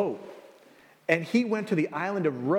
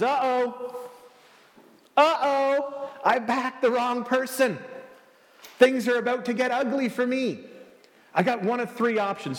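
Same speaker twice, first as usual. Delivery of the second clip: mock panic; 5 semitones higher than usual. Herod's panicked inner voice.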